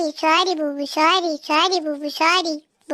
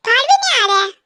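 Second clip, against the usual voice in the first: angry outburst; 5 semitones higher, 4 dB louder than usual. Acted. Wife fuming husband won't come out.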